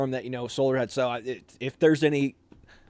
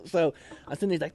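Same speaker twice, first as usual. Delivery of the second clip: ordinary talk, nothing marked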